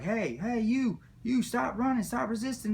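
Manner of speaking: high, barked police orders; mock cop yelling. Cops chasing him, mock shouting high orders. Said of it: "they came, and they were like".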